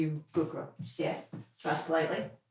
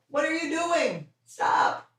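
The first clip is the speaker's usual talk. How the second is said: high startled scold; 10 semitones higher, 4 dB quieter, slower than usual. Real, not acted. Scolding dog.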